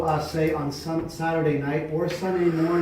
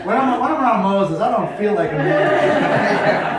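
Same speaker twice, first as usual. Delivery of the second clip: laughing; 7 semitones higher, 9 dB louder, slower than usual. Real, not acted. Laughing at a joke.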